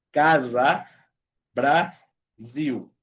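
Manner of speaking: "Casa", then "Brasil" split as bra, ziu; buzzing S. Shown as a demonstration. Teacher breaking it down, syllables with bee-buzz S. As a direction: separate the syllables of "Brasil", S in both words buzzed as Z. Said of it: "un sonido como de abeja".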